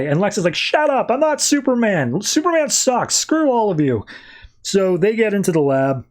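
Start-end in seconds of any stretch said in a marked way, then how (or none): 0.5-4.1 high, mock-angry rant; hammed-up petulant Lex yelling; irritated Lex. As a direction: snap at the crowd in a high pitch. Lex snaps at the crowd.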